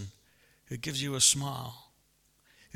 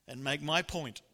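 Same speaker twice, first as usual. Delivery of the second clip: usual speaking voice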